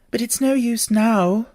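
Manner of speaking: put-on a small girl sighing over herself; young Alice, ruefully. Alice voiced by reader.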